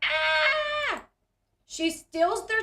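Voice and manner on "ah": put-on high squeal of a startled kid; high startled shriek; mimicked kid scream. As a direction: squeal like the little girl bursting in. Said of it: "she's like".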